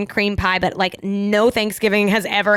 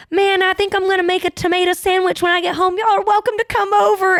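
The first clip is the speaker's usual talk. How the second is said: much higher, louder put-on voice of a drunk friendly guy; drunk friendly stranger; 10 semitones higher, 3 dB louder than usual. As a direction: ham up the cheerful drunk offering a tomato sandwich. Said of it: "and he was like".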